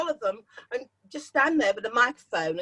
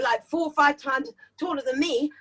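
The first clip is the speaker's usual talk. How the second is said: usual speaking voice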